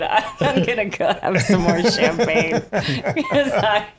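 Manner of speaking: laughing. Real, not acted. Laughing at own brain fart.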